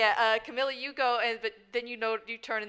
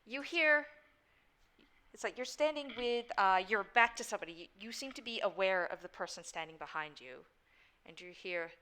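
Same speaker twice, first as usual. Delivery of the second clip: ordinary delivery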